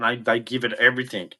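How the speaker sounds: ordinary talk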